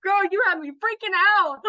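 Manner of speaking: high, loud, laughing relief; squealed girl you had me freaking out; excited relief. Retold or imitated. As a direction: blurt it out, giddy and relieved. Relieved exclamation to buyer.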